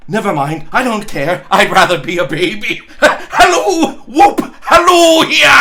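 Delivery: put-on a man happy to be a baby, hollering; giddy Scrooge. Scrooge to himself, giddy and then shouting.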